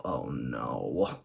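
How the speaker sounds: deep, soft "Oh, no" groan; catchphrase groan, low and soft; very low dismayed groan. Retold or imitated. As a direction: drop very low and quiet in dismay. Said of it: "thinking to myself".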